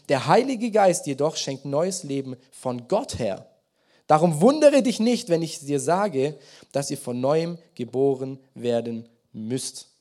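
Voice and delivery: solemn scripture reading from the pulpit. Acted. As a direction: read the passage out like proclaiming it. Reads Jesus' words aloud.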